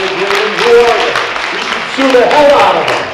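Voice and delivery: loud, heated and indignant, rising to a shout; emotional outburst. Real, not acted. Public speaker at council meeting, angrily demanding to sue, shouting. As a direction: pound the point home, fuming at the lawyers. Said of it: "I apologize if I'm a little emotional".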